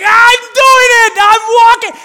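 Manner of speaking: very loud, high, slow gleeful yell; triumphant shout. Retold or imitated. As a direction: crow with childish triumph. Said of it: "it's like".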